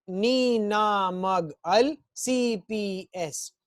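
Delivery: very slow drawn-out syllables; slow recitation. Shown as a demonstration. Teacher reciting syllables slowly for the class. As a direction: stretch each sound out. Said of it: "धीरे धीरे".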